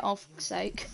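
low, very soft mock grumble; quiet, resigned curse under the breath; imitated weary muttering. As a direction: mutter it as the unimpressed friend. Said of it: "Venice was like".

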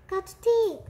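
high, loud self-urging; urgent exclamation. A narrator's reading. Rat digging to escape the cat, urgent cry. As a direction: push the last word out urgently.

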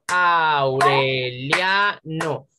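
Aureliano very slowly as Au-re-lia-no; syllable by syllable. Shown as a demonstration. Teacher confirms the answer to class, Aureliano drawn out in four syllables. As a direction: break Aureliano into four long syllables, lia kept together. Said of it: "esta I con esta A no se pueden separar".

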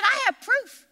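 proudly insisting she has the evidence; excited claim. Retold or imitated. Retelling Corrie eagerly telling her friend she has proof, high excited voice. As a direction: voice jumps up high in eager triumph. Corrie triumphantly citing old letters.

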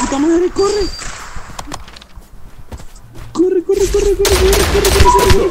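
very high, loud frantic shout; panicked yelling. Real, not acted. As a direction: scream at yourself to run. Being chased in game, yelling.

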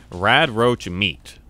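ordinary talk, nothing marked

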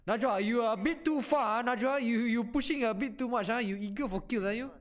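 high, loud teammate telling-off; scolding. Real, not acted. Player scolds Najwa for pushing too far, high loud scolding.

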